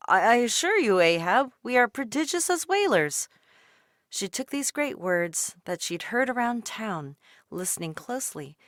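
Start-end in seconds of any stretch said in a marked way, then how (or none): 0.1-3.3 a louder, proud sailor voice; boasting sailor. A narrator's reading. Sailor at the wharf boasting to Ahab, louder. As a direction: assure the captain with hearty pride. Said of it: "overheard sailors".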